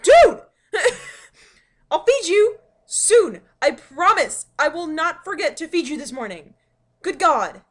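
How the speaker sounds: loud, laughing plea to the cat; laughing exasperated shout. Retold or imitated. Yelling back at the cat.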